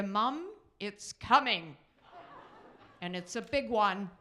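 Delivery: loud, drawn-out hammed-up kid announcement; son's proud announcement. Son proudly announces passing gas.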